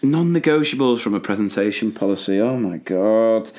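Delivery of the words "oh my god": slow, drawn-out exasperation; exasperated. Real, not acted. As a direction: let the frustration build, dragging each word out. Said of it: "Just how angry you get".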